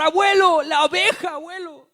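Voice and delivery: put-on high, loud frightened shout of a little girl; panicked child scream. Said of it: "Y ella empezó a gritar". (niña gritando aterrada, aguda y fuerte)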